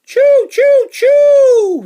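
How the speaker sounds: high, loud whistle call; a big high train whistle for the kids; train whistle choo. Acted. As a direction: toot like a steam train.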